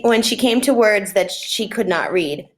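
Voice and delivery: ordinary delivery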